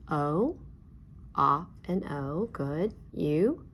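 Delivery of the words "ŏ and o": A as short-o "ah", then O as its letter name "oh"; short "ah", "and", then long O; short then long vowel. Shown as a demonstration. Teacher reviewing vowel sounds with class, short then long O. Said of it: "review short and long vowel sounds".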